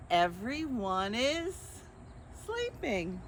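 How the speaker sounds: lightly lilting picture-book narration; reading to children. A narrator's reading. Librarian reading the book to children, gentle storytime. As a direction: read it gently to little listeners.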